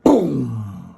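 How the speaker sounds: loud low boom; crash boom. Acted. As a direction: drop it like something huge landing. Giant crashes to ground.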